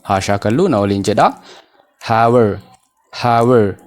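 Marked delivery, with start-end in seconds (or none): 2.1-3.8 "how are" blended into "how-er"; dropped A. Shown as a demonstration. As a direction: run "how are" together, losing the A of "are". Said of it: "A keessaa baasnee pronounce goone". (A keessaa baasuun walitti hidhi)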